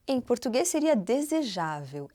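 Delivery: ordinary delivery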